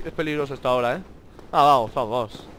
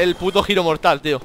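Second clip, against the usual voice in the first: ordinary talk, nothing marked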